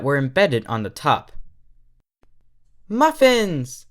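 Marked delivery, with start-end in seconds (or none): 2.9-3.9 high, loud delighted squeal. Acted. Derpy spotting the muffins, a delighted squeal. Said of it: "she squealed".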